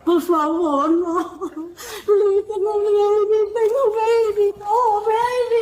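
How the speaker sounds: crying, begging through tears; sobbing plea. Real, not acted. Woman crying about her baby.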